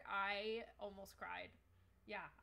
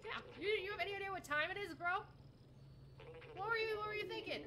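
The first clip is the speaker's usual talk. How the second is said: scolding; 9 semitones higher, 3 dB louder than usual. Acted. Scolding the late friend.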